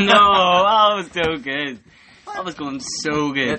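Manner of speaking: loud, high, drawn-out wail; real gutted cry, easing off; dismayed outcry. Real, not acted. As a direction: shout it slowly in disappointment. Rapper breaks off, friend is gutted.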